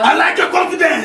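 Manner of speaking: very high, booming praise; exuberant shout. Acted. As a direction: shout the approval at full pitch. Acted exuberant approval repeated.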